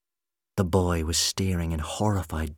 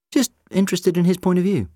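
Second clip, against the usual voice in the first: much higher, louder aggrieved protest; complaining; 12 semitones higher, 8 dB louder, faster than usual. A narrator's reading. The doctor protests to Rose, complaining. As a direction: defend yourself, put out. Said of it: "the doctor complained".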